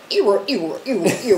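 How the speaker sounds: vocal DJ scratch imitation; record scratch noises. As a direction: scratch the record with your mouth. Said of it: "Did you put this on the record? Was it".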